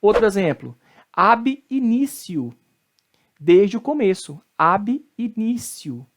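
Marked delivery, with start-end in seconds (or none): 1.1-2.5 slow ab initio, "ab inísio"; Latin T as C. Shown as a demonstration. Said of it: "o T tem som de C". 4.6-6.0 slow ab initio, "ab inísio"; Latin T as C. Shown as a demonstration. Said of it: "o T tem som de C".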